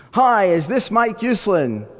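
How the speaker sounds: exuberant voice. Retold or imitated. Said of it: "this exuberant male voice".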